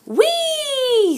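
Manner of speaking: squealed high, joyful whee; a delighted squeal up high; gleeful whee. Acted. Letter climbs tree joyfully.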